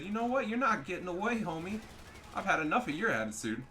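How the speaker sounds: high, worked-up telling-off; playful trash talk at full tilt; mock indignant taunt. Acted taunt at fleeing enemy.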